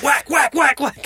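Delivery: loud, high repeated whacks; mock chopping. Boy chopping the chicken head, loud rhythmic whacks. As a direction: hammer out each whack. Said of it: "he's just like".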